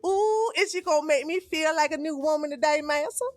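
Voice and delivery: high, eager, fawning mock servant; mock servile slave voice. Streamer parodying a slave pleasing the master, high and fawning. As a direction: beg the master for approval. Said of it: "the way Massa wants me to think".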